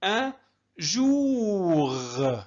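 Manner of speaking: جوغ drawn out very slowly; slow model for students; syllable by syllable. Shown as a demonstration. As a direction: hold جوغ long after أن. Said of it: "ممتاز".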